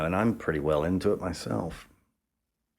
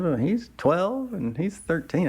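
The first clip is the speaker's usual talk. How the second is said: much higher, slow put-on woman's voice; matronly woman; 11 semitones higher than usual. The mother giving the giant boys' ages, in a slow high voice. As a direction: say it innocently, like nothing's odd. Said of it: "He goes".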